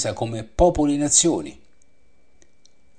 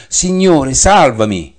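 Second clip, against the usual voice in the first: loud, much higher desperate cry; cry for help; 5 semitones higher, 7 dB louder, slower than usual. A narrator's reading. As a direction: shout it like a drowning man. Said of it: "gridò dicendo".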